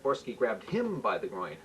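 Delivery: ordinary delivery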